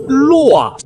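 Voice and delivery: high, drawn exclamation falling away; emphatic proclamation. Acted. Scholar announces the poem's title, emphatic proclamation. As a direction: proclaim the single word grandly. Déclame le titre du poème.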